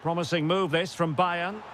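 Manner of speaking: usual speaking voice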